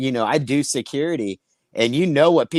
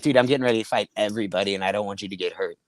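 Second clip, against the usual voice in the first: rushed warning; 6 semitones lower, much faster than usual. Retold or imitated. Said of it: "and I was like".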